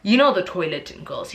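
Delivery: ordinary talk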